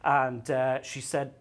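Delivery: usual speaking voice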